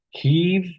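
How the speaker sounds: "Heave" drawn out on a high, long ee; long vowel demo. Shown as a demonstration. Teacher contrasting similar spellings, "Heave" said with a long high ee. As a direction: stretch the vowel in "Heave", pitched well up. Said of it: "heave is pronounced as what?".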